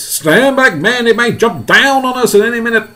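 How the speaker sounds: high, urgent shouted warning; yelling. Acted. Police chief warning fire chief, urgent yell. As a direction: shout them back from danger. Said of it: "yelled the chief of police".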